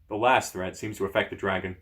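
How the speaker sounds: usual speaking voice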